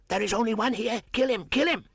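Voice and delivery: shrill, high, loud battle cry; frenzied monkey shout. Acted. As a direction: scream for blood at the panther. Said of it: "a monkey shouted".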